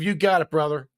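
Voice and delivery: ordinary delivery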